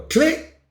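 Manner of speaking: high, sharp click sound; a squeaky imitated igniter click; mock click. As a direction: pop the click up high like a lighter. Mimicking the grill igniter.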